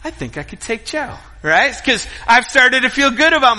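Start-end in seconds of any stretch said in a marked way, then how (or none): 0.0-1.1 softer, muttered inner boast; quiet smug aside; cocky thought. Retold or imitated. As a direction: think it to yourself. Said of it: "I was like".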